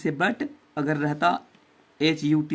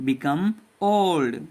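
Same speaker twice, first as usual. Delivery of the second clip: put-on very slow, syllable-clear "become old"; a teacher modelling each word slowly; slow model reading; much slower than usual. Shown as a demonstration. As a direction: spell it out slowly for the class to follow. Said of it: "अब फिर से पढ़ते हैं".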